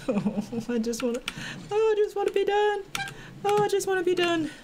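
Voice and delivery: high whiny pleading, near tears; begging the game to end, voice climbing high; scared horror game streamer. Real, not acted. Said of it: "I'm, like, so sweaty right now".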